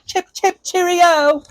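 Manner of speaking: high, hammed-up posh English "chip, chip, cheerio"; playful mock English toff; mock British accent. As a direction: tease him with a silly British voice. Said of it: "he talks funny because he's from England".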